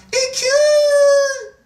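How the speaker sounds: high, loud drawn-out cry; shouted out. Retold or imitated. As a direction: imitate her sudden piercing shout. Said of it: "that's when she shouted out".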